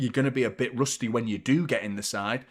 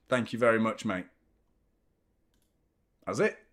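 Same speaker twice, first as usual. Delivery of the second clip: ordinary talk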